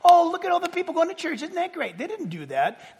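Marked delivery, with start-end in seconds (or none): 0.0-2.0 high, soft, quick gushing; mock delight. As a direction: fake cheery gushing in a small, raised voice. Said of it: "They weren't happy. They weren't going".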